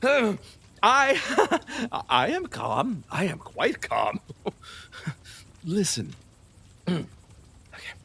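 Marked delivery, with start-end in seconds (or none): 0.0-2.0 high, loud and flustered; losing grip, pleading; agitated. Acted. Herod rattled as Salome will not listen.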